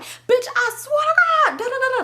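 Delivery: very high, exaggerated angry imitation; mock cussing out. Boyfriend swearing at her by phone, mimicked outburst. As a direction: mimic his outburst, squeaky with indignation. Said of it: "he cussing me out".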